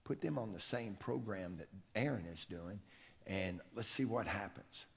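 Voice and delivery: low and soft, barely above a murmur; hushed retold coach; quiet, soft voice. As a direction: speak it softly, like a coach confiding. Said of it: "he spoke real quiet, real soft".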